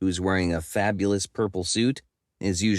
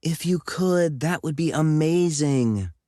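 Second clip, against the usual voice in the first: high, eager and delighted; enthusiastic; 8 semitones higher than usual. Acted. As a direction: gush with excitement. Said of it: "clasps her hands together enthusiastically".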